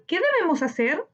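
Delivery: normal talking voice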